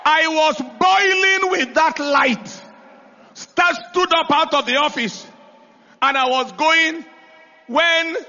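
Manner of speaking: high, slow, emphatic preacher's delivery; fired-up sermon storytelling; full-force preaching. Real, not acted.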